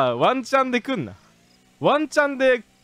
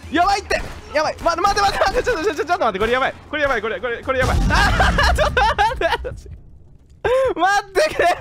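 high, loud frantic pleading; panicked; 9 semitones higher, 3 dB louder, faster than usual. Real, not acted. Player panicking as the game suddenly goes wrong, frantic yelling. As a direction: scramble in real panic, voice cracking up high.

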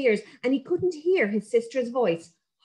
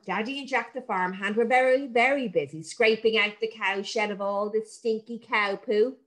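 usual speaking voice

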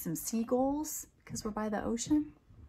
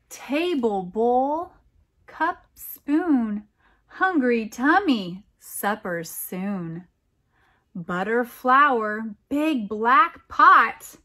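dramatic reading; 2 semitones higher, 9 dB louder, much slower than usual. A narrator's reading. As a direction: burst out the spill like a sudden storm. Reading poem verses.

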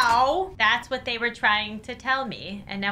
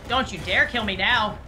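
ordinary delivery

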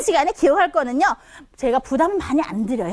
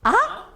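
아 rising like a question; second tone; 9 semitones higher than usual. Shown as a demonstration. Teacher repeats for learners, rising 아. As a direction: push 아 upward from mid to high. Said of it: "미에서 솔로 발음하는 거예요".

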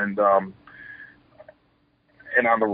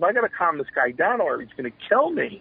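retold worry, pitched up; mildly alarmed inner voice; 7 semitones higher than usual. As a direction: think it aloud in mild mock alarm. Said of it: "what I'm really saying to myself".

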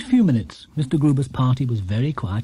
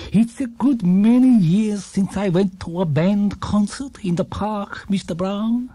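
lightly voiced kindly old shopkeeper, higher, last words slowed and breathy; a gentle old man offering a treat, a touch higher; Mister Gruber's voice; 5 semitones higher, slower than usual. A narrator's reading.

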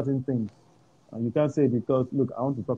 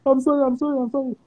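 mock pleading; 11 semitones higher, faster than usual. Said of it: "boy pleading while beaten".

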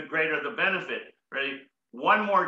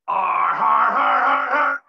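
loud pirate ar har; louder pirate laughter; 8 dB louder, slower than usual. Shown as a demonstration. Asking the crowd for a louder pirate laugh. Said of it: "Give me a louder one".

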